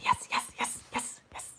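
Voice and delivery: breathy whispered string of yes; whispered yeses. Real, not acted. Reader hushing yes to herself, pleased. Excited muttered agreement.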